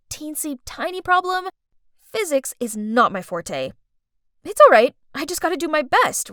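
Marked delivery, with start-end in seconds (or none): 4.4-4.9 loud self-reassurance. A narrator's reading.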